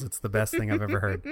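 ordinary talk, nothing marked

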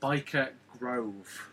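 slow, deliberate "Biker Grove" with a standard long o; measured demo, "Grove" without the Geordie oo; careful non-Geordie. Shown as a demonstration. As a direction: spell out "Grove" carefully to rhyme with stove. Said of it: "There we go".

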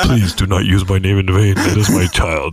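deep, solemn voice from above; God voice. Pretend. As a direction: rebuke him like God. God keeps speaking.

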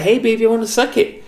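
high-pitched imitation of a flirty woman; French prostitute's come-on. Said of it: "she was French".

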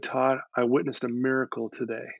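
soft, subdued retelling of her wonder; hushed awe. Retold or imitated. Client reports a miracle to counselor after sobbing, hushed. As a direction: lightly imitate her, quietly moved.

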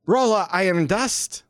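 high-pitched character. Acted. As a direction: say your name proudly. GM voices Rolla.

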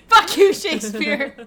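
high, loud laughing curse; laughing shout. Real, not acted. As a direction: yell it through laughter. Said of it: "laughing outburst".

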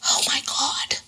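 breathless whispered dread; scared whisper. Real, not acted. Host imagining putting in contact lenses, scared whisper. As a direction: whisper it as if the thought alone scares you.

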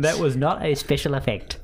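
helium voice. Real, not acted. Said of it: "This is me on helium".

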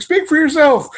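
high mock voice. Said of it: "He's like".

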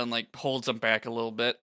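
normal talking voice

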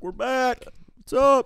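high, held-up comeback greeting; hammed-up, high-pitched arrival call; mock triumphant announcement. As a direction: announce a grand return in a high voice. Triumphant return announced in retold story.

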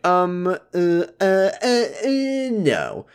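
high, drawn-out mock hesitation; exaggerated reluctance. Josh refusing to fetch his sister, exaggerated reluctance. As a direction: stall with rising hems, then a squeaky refusal.

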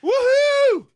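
high, loud whoop of joy; dad cheering his daughter coming out; mock cheer. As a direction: cheer like you won the lottery. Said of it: "I'm gonna be like".

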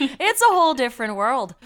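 loud, high-pitched Letterkenny Canadian; Letterkenny accent. Acted. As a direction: push a broad Letterkenny-style Canadian accent, loudly. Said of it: "it's like a Letterkenny accent".

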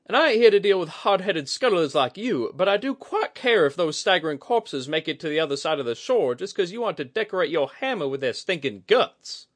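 high, loud scornful sailor voice; salty retort. A narrator's reading. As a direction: spit it back at the hothead. Kudaka sea-dialect retort.

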